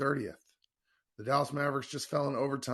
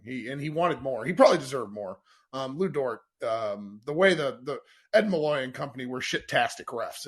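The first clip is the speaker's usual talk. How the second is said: loud rant; 2 semitones higher, 9 dB louder than usual. Real, not acted. Host ranting about bad refs.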